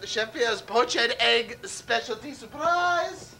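slow, grand French chef announcement; mock French chef. Chef unveils his specialty in a mock French accent. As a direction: present the dish in a hammed-up French accent. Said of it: "I'm out of character".

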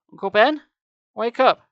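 high, loud rousing call; shouting. A narrator's reading. Gabe tries to rouse his limp uncle, shouting. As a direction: shout to wake him. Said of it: "I shouted".

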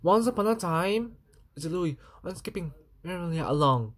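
ordinary delivery, nothing marked

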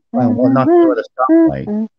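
cartoon voice. Squeaky cartoon voice.

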